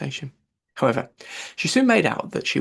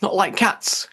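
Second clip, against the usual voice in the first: higher, indignant passionate outburst; shrill passionate; 6 semitones higher, 3 dB louder, slower than usual. Acted. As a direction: fling it back, offended and shrill. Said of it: "in a shrill, passionate voice".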